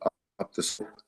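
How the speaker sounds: dropping out over a bad line; choppy audio, words cutting out; breaking up connection. Real, not acted. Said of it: "Oh, you're breaking up a little bit".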